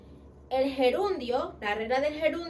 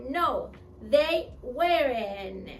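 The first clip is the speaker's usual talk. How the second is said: slow, clear "No, they weren't"; slow demo answer; much slower than usual. Shown as a demonstration. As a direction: spell out each word for the class. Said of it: "Y contestamos". (respuesta lenta y clara)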